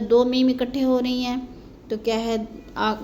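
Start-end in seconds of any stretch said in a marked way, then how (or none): none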